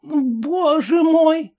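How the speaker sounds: put-on high, slow aghast old lady; a hammed-up cry of horror, very high; horrified cry. A narrator's reading. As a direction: clutch your heart in mock horror. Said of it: "в ужасе закричала странная гостья".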